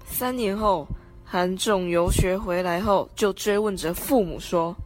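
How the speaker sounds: normal talking voice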